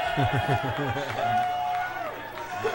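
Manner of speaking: high-pitched giggle; high laugh. Real, not acted. Laugh after the move.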